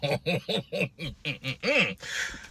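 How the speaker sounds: quiet amused chuckle; soft laughter. Real, not acted. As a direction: laugh softly at the absurdity. Laughs at own story.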